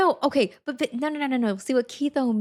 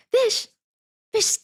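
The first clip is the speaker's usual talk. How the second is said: excited shout; 13 semitones higher, 6 dB louder than usual. Real, not acted. Streamer greets a donor excitedly.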